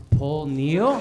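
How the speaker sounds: loud, rising, disbelieving name; incredulous outburst. Acted. Fan realizing his missed chance, incredulous outburst.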